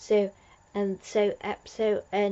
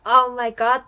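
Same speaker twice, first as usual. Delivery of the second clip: excited; 4 semitones higher, 8 dB louder than usual. Real, not acted. Gushing about tardis model.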